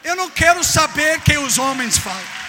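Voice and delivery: loud fiery preaching; preaching at full force. Acted. Preacher shouting at the congregation, fiery and loud. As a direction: shout it from the pulpit.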